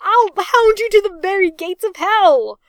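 high, loud furious shriek. A narrator's reading. Reg threatening Arthur as he lunges, shrieking. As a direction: scream the threat at full pitch. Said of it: "Reg shrieked".

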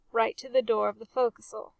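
usual speaking voice

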